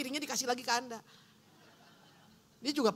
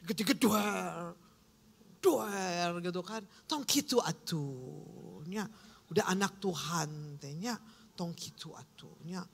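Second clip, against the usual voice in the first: slow, low mock Sundanese scolding; mock Sundanese grumble; 5 semitones lower, slower than usual. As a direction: grumble it like an angry Sundanese uncle. Preacher mocks angry Sundanese person. (logat Sunda menggerutu, pelan dan rendah)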